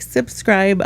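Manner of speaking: ordinary talk, nothing marked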